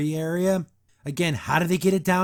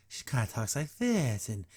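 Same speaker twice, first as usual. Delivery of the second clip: softer imitation of an odd talker; weird way of talking; 5 semitones lower, 9 dB quieter than usual. Reviewer imitating a character's talking habit, lightly odd and softer. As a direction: lightly mimic someone's strange speech, dropping the volume. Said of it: "she has this weird way of talking".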